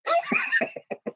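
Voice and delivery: high burst of laughter. Real, not acted. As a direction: laugh at your own joke. Laughs at own joke.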